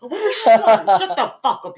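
real laughter breaking through the insult; loud, slow laugh-filled shut up. Real, not acted. Finally gets the joke, laughs.

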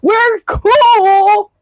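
high, drawn-out playful crowing; playful boast. Pretend. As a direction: show off with a high, stretched cheer. Boasting about the new microphone.

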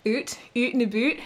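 "Oot" and "boot" with a long oo for the ow; hammed-up Canadian "Oot, oot and a boot"; exaggerated Canadian ows. Shown as a demonstration. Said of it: "little Canadianisms, sort of the ows".

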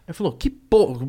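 ordinary talk

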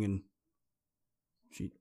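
normal talking voice

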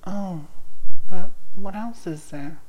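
normal talking voice

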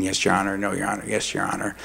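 low, soft, dutiful yes-sir replies; bow your head and murmur to the judge; meek deference. Retold or imitated. Father mumbling meekly to the judge in court.

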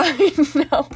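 laughing through words. Real, not acted. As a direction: giggle the words out. Laughs while apologizing.

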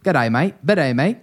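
mock Australian greeting with a lilting rise; Australian accent. Demonstrating his hybrid accent to co-host, Australian accent. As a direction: put on a broad Australian accent. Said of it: "a British Australian hybrid".

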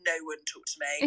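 low, fast and curt; stern low mutter. Retold or imitated. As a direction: grumble it under the breath. Gym mode stern quote.

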